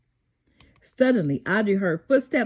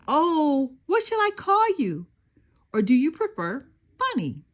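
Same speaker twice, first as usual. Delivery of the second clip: put-on higher, excited child voice; delighted little girl; 5 semitones higher than usual. A narrator's reading. Little girl delights over her new bunny, excited. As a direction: coo over a new toy.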